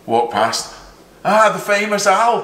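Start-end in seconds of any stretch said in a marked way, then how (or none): 1.2-2.4 mock grand greeting. Golfer's greeting retold.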